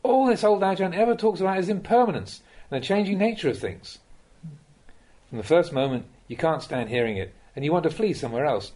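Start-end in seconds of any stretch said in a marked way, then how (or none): 0.0-4.0 pitched up, imitating a fed-up student; mock frustrated complaint. Students griping that the old Ajahn repeats himself, mock frustrated. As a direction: whine it like a bored disciple griping. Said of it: "burst out complaining in frustration".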